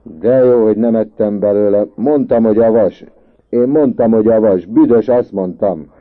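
loud, high I-told-you-so yell; excited, hollered self-defence; shouting. A narrator's reading. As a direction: shout over everyone, insisting. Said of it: "kiabált Andrássy".

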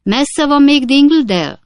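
high, loud shouted question; calling out. A narrator's reading. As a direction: call the question across a distance. Pickwick calls across the garden.

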